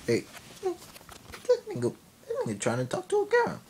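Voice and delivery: high-pitched mocking dad voice; jeering dad. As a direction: squeal it like a bully calling his crew. Dad mocking him to his friends.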